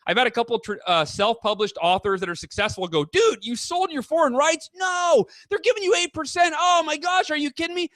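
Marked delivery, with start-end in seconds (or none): 3.1-7.9 high-pitched, incredulous friend voice; mock disbelief. Self-published author friends reacting to his deal, high mock disbelief. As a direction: act out a scandalized, appalled buddy.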